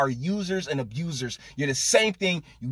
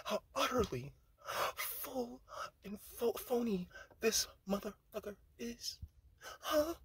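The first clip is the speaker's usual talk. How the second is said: airy, breathy voice, quiet and higher; mocking Cory Booker; 8 semitones higher, 15 dB quieter than usual. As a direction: parody his soft, airy emotional delivery. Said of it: "I can do it too, guys".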